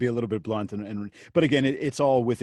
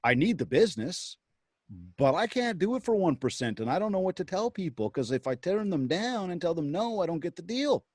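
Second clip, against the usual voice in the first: higher, fretful retold agent; worried agent; 7 semitones higher than usual. Retelling a busy mom agent's dilemma, fretful and higher. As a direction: voice her worry, pitched up. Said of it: "And she's like".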